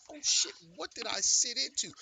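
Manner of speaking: high-pitched mock dismay; imitated alarmed newcomer. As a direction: mimic a guy realizing he's in trouble. Newcomer's alarmed reaction, acted out.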